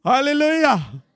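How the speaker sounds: loud, slow, laughing "hallelujah"; big laughing hallelujah to the crowd. Real, not acted. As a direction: laugh it out as a joyful shout.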